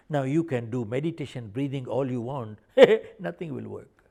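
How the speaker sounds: ordinary delivery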